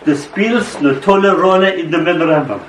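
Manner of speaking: hammed-up German accent; bad German accent. Memory suddenly addresses the poet, bad German accent. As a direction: put on a clumsy, mock German accent. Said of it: "in a bad German accent".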